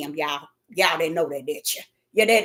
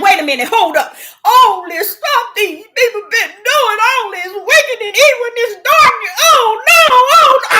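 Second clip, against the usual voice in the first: raised, high protest building to a shout; cutting up; 8 semitones higher, 3 dB louder than usual. Retold or imitated. Preacher upset with God on the highway, cutting up loudly. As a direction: re-enact an outburst, climbing louder to "oh no". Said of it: "I was cutting up on that highway".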